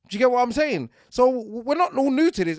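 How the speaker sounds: loud, high, fired-up ranting; heated rant. Real, not acted. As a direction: go all out, shouting passion.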